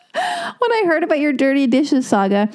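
can't get the words out for laughing. Real, not acted. Moved by Sam's email, laughing and tearing up. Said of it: "repeats the line laughing".

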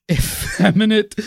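giggling while saying it; laughing. Retold or imitated. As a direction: laugh through the word. Mocking limp wrists.

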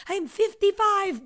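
a hint of a childish woman; mock girlish voice. Voicing pigtailed woman.

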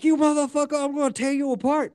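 high, raging threat screamed at the killer; furious screaming. Retold or imitated. Woman rushes Dahmer in court, high screaming rage. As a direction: scream it as you charge at him. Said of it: "a chick rushed him and was like".

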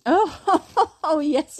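high, loud amused oh; surprised laugh. Real, not acted. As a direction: caught off guard, laughing. Niece demands to talk.

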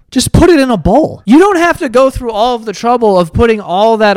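disgusted shouting at a video; angry rant. Real, not acted. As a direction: rant at the screen in disbelief. Ranting at a food video.

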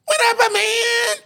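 shouted high-pitched greeting to the crowd; loud hype entrance. Retold or imitated. Imitating a comic bursting on stage with a high yelled greeting. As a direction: burst onstage yelling hello.

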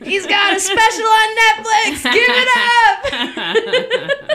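high, loud comedy-club intro, last words stretched out; hype host introduction. Retold or imitated. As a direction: imitate an emcee whipping up the crowd. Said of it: "big time liar when I'm hosting".

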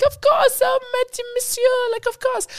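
very high-pitched, hammed-up French hotelier; imitated French hotel staff. French hotel staff agreeing to extend a guest's stay, high mock-obliging voice. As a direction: mimic fussy French reception staff way up high. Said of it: "They're like".

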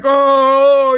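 loud, startled "Oh". Retold or imitated. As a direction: jolt as if caught out. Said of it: "The guy'd be like".

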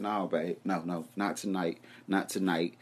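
soft, low no babe; mock tender refusal; gentle let-down. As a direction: sweet-talk her out of coming. Softly turning girlfriend down.

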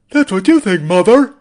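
a young man's put-on whine at his mother; hammed-up Norman answering mother; Norman Bates impression. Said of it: "that was my impression".

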